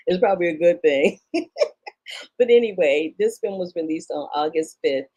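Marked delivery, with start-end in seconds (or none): 1.2-2.3 high, breathy laugh; short laugh. Real, not acted. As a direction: chuckle at your own joke. Laughs after her wry remark.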